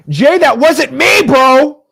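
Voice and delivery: loud, high indignant denial; indignant protest. Real, not acted. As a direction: insist loudly it wasn't you. Chat mocks him as robot.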